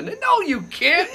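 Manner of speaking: high, drawn-out mock objection; comic inner-voice protest; emphatic self-contradiction. Own thought arguing back.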